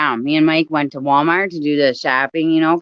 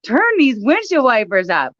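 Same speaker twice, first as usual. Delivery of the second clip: exasperated demand; 9 semitones higher than usual. Retold or imitated. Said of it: "I felt like saying to him".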